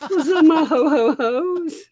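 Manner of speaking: high squealing Santa greeting, laughing; jolly ho ho ho. Pretend. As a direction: giggle through a loud Santa ho ho ho. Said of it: "I need to do a rap Santa".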